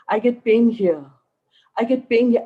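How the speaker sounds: a worried patient's complaint swelling with every repeat; rising insistence. Retold or imitated. Woman repeating her complaint, building.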